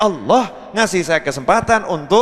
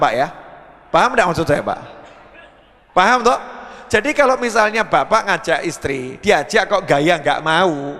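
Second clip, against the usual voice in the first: ordinary delivery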